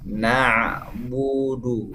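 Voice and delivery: "Na'budu" slow, lips rounded forward on the bu; lips pursed. Shown as a demonstration. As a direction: stretch "Na'budu" with pouted lips. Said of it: "bibir saya. ... bibirnya monyong ke depan".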